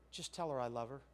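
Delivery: quiet, gentle divine prompt; still small voice. Retold or imitated. Preacher voicing God's quiet message about the woman. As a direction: say it low and tender-soft. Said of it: "that still small voice".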